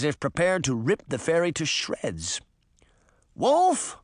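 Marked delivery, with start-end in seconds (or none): 3.3-4.0 high warning yell; commanding high-pitched cry; sharp shout. A narrator's reading. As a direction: shout it to halt an attacker. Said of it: "Hampstead shouted".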